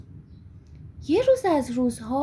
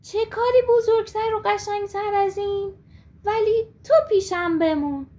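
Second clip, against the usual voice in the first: eager high, loud yes; happy; 5 semitones higher, 4 dB louder than usual. Acted. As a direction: burst out with delight. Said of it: "با شادی گفت".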